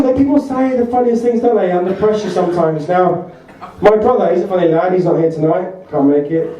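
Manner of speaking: usual speaking voice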